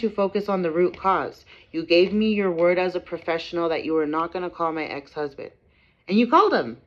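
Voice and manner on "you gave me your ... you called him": upset ranting. Real, not acted. Ranting about the broken promise.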